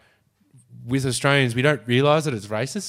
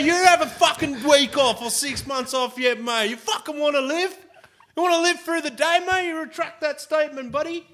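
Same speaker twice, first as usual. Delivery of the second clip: high, ranting mock fury at the boss; outraged worker yelling down the phone; furious tradie; 8 semitones higher than usual. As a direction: blow up like a tradie told to stay home.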